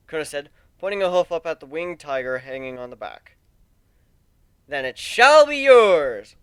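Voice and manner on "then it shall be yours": grand, loud and slow, higher; confident. A narrator's reading. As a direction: proclaim it like a gallant knight. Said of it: "Warren said confidently".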